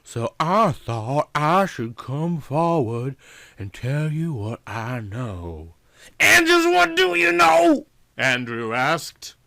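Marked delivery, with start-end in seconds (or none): 0.0-5.7 Yvonne's voice. A narrator's reading. Yvonne asking the detective his name, Southern and slow. 6.2-7.9 Andrew's voice. A narrator's reading.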